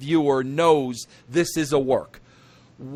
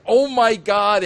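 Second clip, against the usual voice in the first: mock serious gasp; 7 semitones higher, 6 dB louder than usual. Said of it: "so serious".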